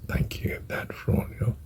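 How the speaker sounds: trailing whisper on the out-breath; hushed prayer on the exhale; whispered mantra. Shown as a demonstration. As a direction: let the words fade into a whisper. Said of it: "Breathe out".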